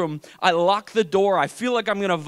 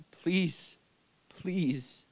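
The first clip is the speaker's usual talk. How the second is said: much softer, spaced-out begging; hushed plea; 9 dB quieter than usual. Retold or imitated. Waiting for the surgeon's news, hushed desperate plea. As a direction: beg God under your breath. Silent prayer while awaiting bad news.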